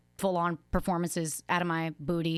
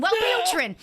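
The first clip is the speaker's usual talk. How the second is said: mock shout; 10 semitones higher, 4 dB louder, slower than usual. Imitating her repeated sketch line.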